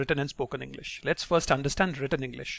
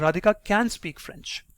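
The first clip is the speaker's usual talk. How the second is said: higher-pitched rebuttal, "can" punched; emphatic "can", correcting the listener; stress on can; 4 semitones higher than usual. Shown as a demonstration. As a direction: insist on "can" to clarify. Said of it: "stressing on the word can and clarifying".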